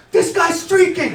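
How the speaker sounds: high loud announcement to the section; mock shout; shouting alarm. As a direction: yell out to the whole section. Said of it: "he's like".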